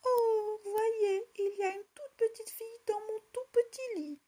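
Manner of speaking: high, loud cub voice, rushing; little bear crying out. A narrator's reading. Little bear calls the family to his bed, high and loud. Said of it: "cria de sa toute petite voix".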